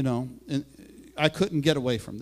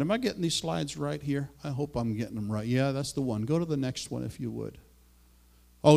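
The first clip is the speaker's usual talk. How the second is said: ordinary talk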